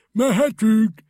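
high, loud call; calling up to the crane driver; loud cry. A narrator's reading. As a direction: shout up to the crane. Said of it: "s felkiáltott".

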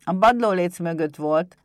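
loud little imp answering; reply in a loud goblin voice; kobold voice. Acted. Pumukli answers Éder about the hiding place, loud kobold voice.

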